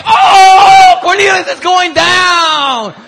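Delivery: high, loud, slow crowd yell; crowd shout. Retold or imitated. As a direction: cheer like kids at a fight. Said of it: "everyone was like".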